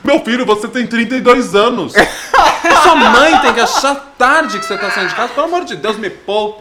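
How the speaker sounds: loud, high exasperated laugh-rant; laughing outburst. Real, not acted. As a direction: shout through laughter in disbelief. (desabafo rindo alto)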